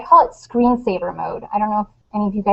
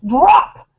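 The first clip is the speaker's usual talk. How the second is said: mom playing drill sergeant to jolt her son; playful drill sergeant command; army sergeant voice; 3 semitones higher, slower than usual. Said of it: "in my best Army Sergeant voice".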